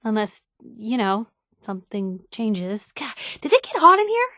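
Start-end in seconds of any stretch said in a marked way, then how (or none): 2.9-4.3 higher, louder, fast flustered blurt; embarrassed flustered rush after talk of romance. Acted.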